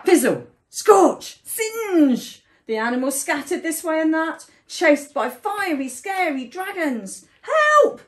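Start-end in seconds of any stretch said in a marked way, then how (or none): 0.1-2.4 loud, slow, punched fire noises; explosive storybook sound effects; dramatic sound words. A narrator's reading. Dragons' flames strike suddenly. 7.4-8.0 high, loud panicked call; piercing storybook cry; cry for help. A narrator's reading. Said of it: "they cried".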